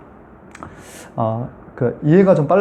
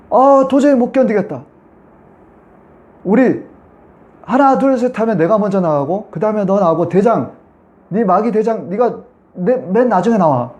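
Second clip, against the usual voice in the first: higher demon voice giving up and counting off; defeated demon; 6 semitones higher than usual. Retold or imitated. Demon telling the other demons to leave one by one, high put-on voice. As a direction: play the cornered demon ordering the others out.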